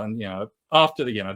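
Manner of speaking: normal talking voice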